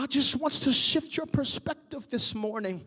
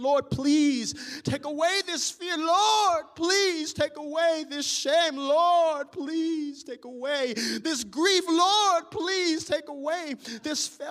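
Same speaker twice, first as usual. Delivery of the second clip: crying out in prayer; 3 semitones higher than usual. Acted. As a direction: let the prayer swell into a cry. A prayer rising to a cry.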